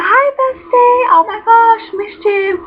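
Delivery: high-pitched over-the-top fake delight; fake-nice bestie gush. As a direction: gush over someone you secretly can't stand. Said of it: "pretend to sit there and say, like, be nice".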